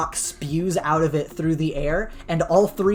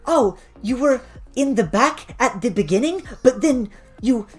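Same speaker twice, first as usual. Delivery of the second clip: giddy Triffany; 8 semitones higher than usual. Acted. Winged racer gushing to Echo about the race, giddy and high. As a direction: gush excitedly, pitched well above your own voice. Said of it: "is giddy again and is like".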